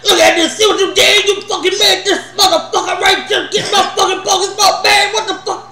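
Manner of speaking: hammed-up shouting about a parking spot; mock yell. Teller imitates Ray shouting about his parking spot, high laughing yell.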